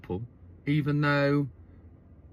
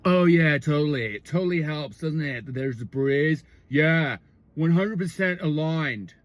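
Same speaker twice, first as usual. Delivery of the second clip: frat bro. Retold or imitated. Boyfriend agreeing with her about the breeze, frat-bro voice. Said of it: "like, a sort of a frat bro".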